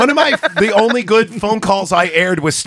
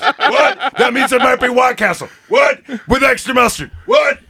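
a hammed-up wrestler rant with shouts and quizzical higher "What?"s; Stone Cold Goldblum; 4 semitones higher than usual. Leaving a voicemail, he rants as a shouting mock wrestler-Goldblum. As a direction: yell trash talk like a wrestler doing Goldblum. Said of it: "can you do the Stone Cold voice with the".

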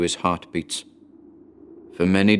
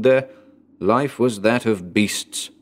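ordinary delivery

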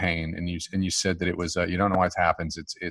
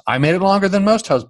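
excited boast; 12 semitones higher, 8 dB louder, faster than usual. Real, not acted. Mock boast about lasting.

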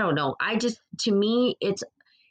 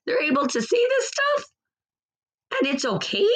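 shrieks up high in mock disbelief; incredulous disbelief; 8 semitones higher than usual. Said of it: "I'm like".

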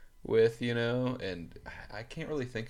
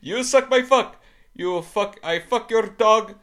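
higher and louder mock Indian accent, almost shouting; hammed-up Indian English trash talk; caricatured Indian English swearing, pitched up and loud; 12 semitones higher, 12 dB louder than usual. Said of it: "videos of Indian dudes cursing each other".

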